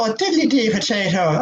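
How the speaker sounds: sing-song comic Irish accent. Shown as a demonstration. Actor showing off a good Irish accent, Irish. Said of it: "a good Irish accent".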